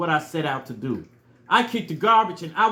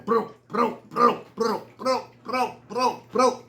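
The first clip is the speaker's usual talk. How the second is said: galloping noises; 4 semitones higher, slower than usual. Retold or imitated. Imitating galloping sound.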